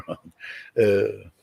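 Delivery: ordinary talk, nothing marked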